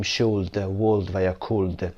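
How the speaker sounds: "should، would و یا could" with the L wrongly sounded before the d; mispronounced "should، would و یا could", as shool-d, wool-d, cool-d; pronounced silent L. Shown as a demonstration.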